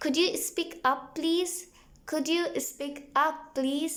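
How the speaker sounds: very slow, clearly separated English words; slow model phrase. Shown as a demonstration. Teacher slowly drilling a phone request for learners. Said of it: "तो बोलिए".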